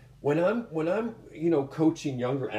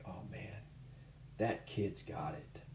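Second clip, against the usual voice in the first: hushed, breathy admiration under the breath; whispered awe; 4 semitones lower, 15 dB quieter than usual. Retold or imitated. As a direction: whisper it like a secret discovery. Coach's private awe at a kid.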